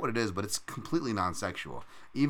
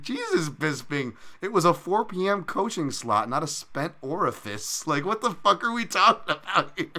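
laughing exasperation; 9 semitones higher, 5 dB louder than usual. Retold or imitated. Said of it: "I'm like".

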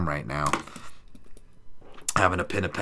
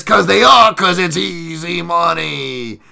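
higher, slow sing-song put-on voice; mock catchphrase; 9 semitones higher, 10 dB louder, slower than usual. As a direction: drawl it out like a cheesy hype man. Mock catchphrase about easy money.

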